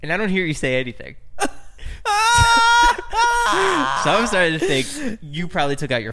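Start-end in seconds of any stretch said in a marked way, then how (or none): 1.2-4.0 high wheezing laugh; laughing hard. Real, not acted. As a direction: crack up at your own story. Laughing at his own story.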